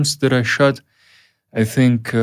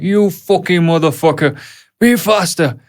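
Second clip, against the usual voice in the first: put-on yelling in a higher Irish accent; angry Irish accent; 7 semitones higher, 4 dB louder than usual. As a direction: bark it like an angry Irish boss. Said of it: "in his, uh, Irish accent".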